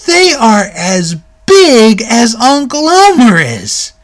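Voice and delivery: "big" punched high and drawn out; stress on big. Real, not acted. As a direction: stretch "big" in amazed emphasis. Stresses guards' astonishing size.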